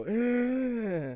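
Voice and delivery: high drawn-out "ugh" of irritation; exasperated groan. Shown as a demonstration. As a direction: groan as if it pains you. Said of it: "just makes me go".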